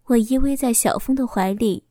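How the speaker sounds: ordinary delivery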